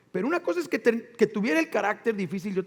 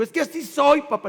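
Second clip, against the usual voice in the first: son's defiance; 6 semitones higher, 5 dB louder, faster than usual. Retold or imitated. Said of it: "me dijo mi hijo".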